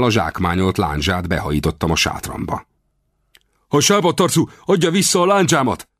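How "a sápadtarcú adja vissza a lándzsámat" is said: high-pitched shouted demand, acted in narration; angry yell. A narrator's reading. Guard demanding his lance back, angry high-pitched yell. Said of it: "ordította az őr".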